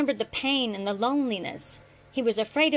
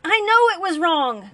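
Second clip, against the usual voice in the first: loud, higher shout; shouted back; 9 semitones higher, 9 dB louder than usual. A narrator's reading. Father shouting back at his son, guilty loud shout. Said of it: "Grant shouted back".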